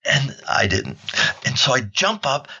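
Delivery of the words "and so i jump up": usual speaking voice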